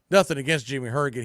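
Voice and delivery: normal talking voice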